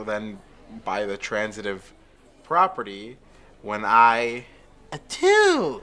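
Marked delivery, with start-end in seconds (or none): 5.1-5.8 sneeze. Acted.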